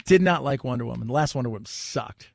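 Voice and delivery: ordinary delivery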